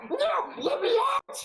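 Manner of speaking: high, shouted squeaky imp voice; a panicked little imp yelling to be let out; giggly sneering imp. Acted. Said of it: "says the imp".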